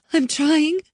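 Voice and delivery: barely coping; scared girl. Acted. Girl answering Riley, high and frightened. Hannah's voice.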